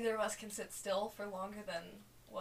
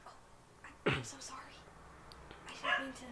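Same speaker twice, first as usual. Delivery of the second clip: whispered apology; 4 semitones higher, faster than usual. Acted.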